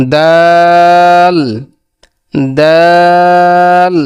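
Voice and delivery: long, level দাল with tongue tip at upper-teeth roots; tongue-tip Arabic dal. Shown as a demonstration. Teacher models দাল with tongue on upper-tooth roots, drawn out. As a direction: stretch দাল on one note, crisp dental d. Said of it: "জিহ্বার আগাম সামনে উপরের".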